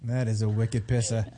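put-on low-pitched Boston accent, r dropped; deep-pitched mock Bostonian. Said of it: "the accent, the, uh, the whole thing".

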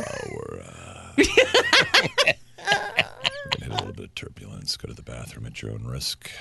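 calm captain on the intercom; airline pilot voice. Pretend. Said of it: "you've got the perfect pilot voice".

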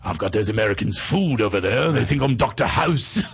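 smug Brit gloating; Hugh Laurie English accent. Retold or imitated. Hugh Laurie on English TV, smug English accent. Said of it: "as soon as he gets on English T V".

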